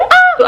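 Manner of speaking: high, loud excited squeal; imitated fangirl shriek; fangirl scream. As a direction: scream with giddy delight. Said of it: "fangirling together".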